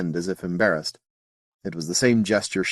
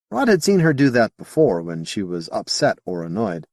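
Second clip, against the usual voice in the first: ordinary delivery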